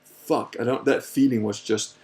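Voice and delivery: normal talking voice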